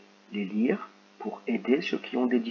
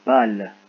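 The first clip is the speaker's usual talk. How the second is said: short word said louder and distinct; clear dictation; 8 dB louder than usual. Shown as a demonstration. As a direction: hit it clearly so they hear the sound. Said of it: "C'est parti".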